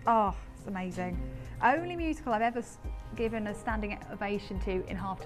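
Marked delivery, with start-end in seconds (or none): none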